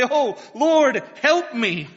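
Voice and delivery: loud, high cry to the Lord; urgent plea. Acted. Praying believer crying out loud to the Lord. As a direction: plead with all you have. Believers plead like the Canaanite woman.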